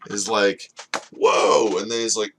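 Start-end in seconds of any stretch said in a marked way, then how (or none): 1.1-1.8 loud, acted whoa of surprise; startled reaction. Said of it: "so the guy who was reacting is like".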